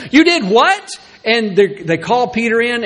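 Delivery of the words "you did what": high incredulous mock outrage; indignant church. Church leaders confront Peter, high and incredulous. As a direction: act the church leaders' disbelief. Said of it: "they're immediately upset".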